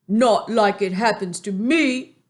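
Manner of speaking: BFG voice. A narrator's reading. Reader voices the giant to listeners. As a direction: lift the voice into the big kind giant.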